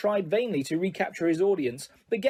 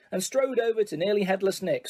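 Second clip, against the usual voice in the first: plain reading voice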